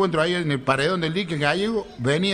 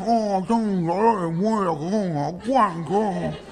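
voz aguda con la boca hinchada, quejándose; high, muffled toothache moan; dolor de muela; 4 semitones higher than usual. Acted. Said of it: "parecía que traía una bocha dentro de la boca". (con dolor de muela y la boca llena)

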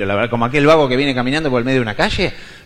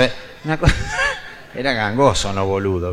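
ordinary talk, nothing marked

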